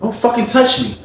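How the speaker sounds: high-pitched, indignant retold warning; straight-up angry warning. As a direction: act out standing up to a bully. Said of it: "he told Booker straight up".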